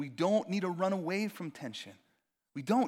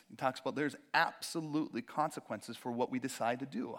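ordinary delivery

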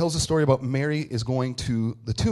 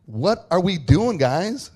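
high, loud, drawn-out exasperated plea; fed-up exasperation; 6 semitones higher, 7 dB louder, slower than usual. Acted. Mary to the hiding men, exasperated. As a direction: play Mary done with everyone. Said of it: "she's done".

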